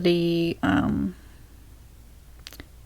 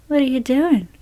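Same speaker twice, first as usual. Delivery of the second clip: lightly voiced mom, pitched up; higher-pitched mock parental query; imitating mom's puzzled question; 5 semitones higher than usual. Imitating mom walking in on the filming, lightly puzzled. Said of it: "Just like that. She says, just like that too".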